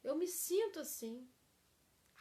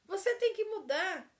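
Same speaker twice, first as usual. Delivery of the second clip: loud, higher blaming command; accusing demand; 6 semitones higher, 6 dB louder than usual. Shown as a demonstration. Teacher shows the blaming spouse, loud demand. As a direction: bark it at your partner. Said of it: "É uma frase que a gente é muito falada".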